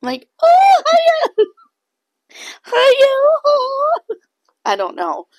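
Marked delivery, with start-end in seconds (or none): 0.4-4.1 very high, loud squeal of a voice; mock a man's squeaky German-accented hello, laughing; shrieky falsetto imitation, much higher and louder; high-pitched German accent. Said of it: "a really high-pitched voice".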